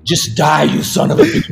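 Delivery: laughing through quote. Retold or imitated. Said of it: "he goes".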